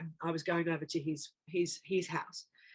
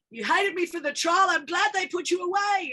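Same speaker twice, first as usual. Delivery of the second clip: high, loud accusing yell; shouting the line at her mother, high and loud; retold screaming; 11 semitones higher, 6 dB louder than usual. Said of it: "And I'm screaming at her".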